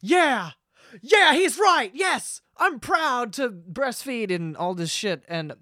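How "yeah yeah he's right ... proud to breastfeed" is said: high loud cheering voice, slowing on proud; hammed-up eager agreement; mock proud mothers. Commenting mothers cheering him on, high mock enthusiasm. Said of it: "a bunch of mothers were in the comments like".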